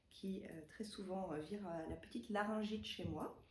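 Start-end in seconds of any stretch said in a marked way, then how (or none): none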